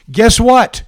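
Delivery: usual speaking voice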